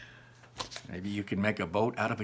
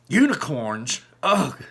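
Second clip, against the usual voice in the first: a higher, louder shuddering ugh; disgusted shiver; 3 semitones higher, 6 dB louder, slower than usual. Acted. Ian shuddering at the horrid unicorns, disgusted. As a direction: recoil at the thought. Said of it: "said Ian with a shiver".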